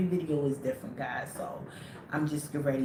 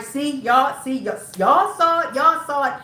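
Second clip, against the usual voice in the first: retold urgent insistence; 8 semitones higher, 8 dB louder than usual. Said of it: "I'm like".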